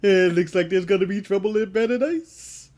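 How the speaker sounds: goofy cartoon caveman voice; lightly voiced cartoon caveman; Barney Rubble voice. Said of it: "I went with Barney Rubble".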